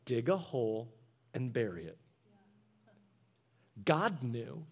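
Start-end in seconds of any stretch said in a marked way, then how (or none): 0.0-2.0 low, soft voice of God; God's voice. Retold or imitated. God tells them what to do, low and soft. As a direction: answer quietly from above, as God. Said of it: "and God's like".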